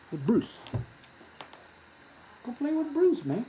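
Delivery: high, sing-song telling-off of a pet; gentle dog scolding. Real, not acted. Host tells his dog to behave and go play, high sing-song.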